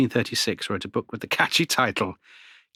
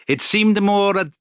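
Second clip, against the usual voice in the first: a hint of an Italian accent, higher and slower; 9 semitones higher, 5 dB louder, slower than usual. Historian quoting a disgusted Italian traveller, mock Italian accent. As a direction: lightly put on an Italian accent. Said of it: "I won't do it in an Italian accent".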